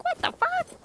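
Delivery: high-pitched shocked yelp; mock outburst. Streamer imagining a slip on a family-friendly stream, mock outburst. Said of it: "I'd be like".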